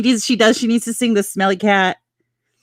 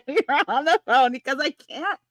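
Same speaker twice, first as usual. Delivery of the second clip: laughing retort; 6 semitones higher, 5 dB quieter than usual. Retold or imitated. Confessing rude goodbye through laughter.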